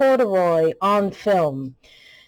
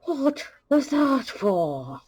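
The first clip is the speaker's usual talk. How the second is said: slow hurt protest; 7 semitones higher, much slower than usual. Acted. Hurt Bond reacts to insult.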